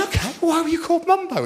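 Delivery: high, quick curious ask; eager question. Retold or imitated. Comedian quizzing the man, high and quick. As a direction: fire it off brightly. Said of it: "And I went".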